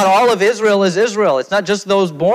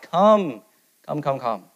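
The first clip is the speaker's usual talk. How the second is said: soft, fading gentle calls; a hushed welcoming call; soft invitation; 3 dB quieter, slower than usual. Acted. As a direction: beckon them in tenderly. Preacher inviting listeners to come.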